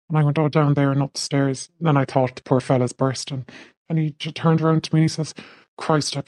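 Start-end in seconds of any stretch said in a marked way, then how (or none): none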